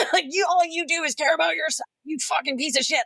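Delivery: mock furious rant. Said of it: "I'm like".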